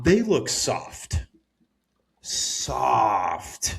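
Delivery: drawn-out teasing jab, shouted at the end; mocking taunt. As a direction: taunt them slowly, then shout. Taunting the English team.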